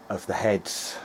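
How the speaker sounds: ordinary talk, nothing marked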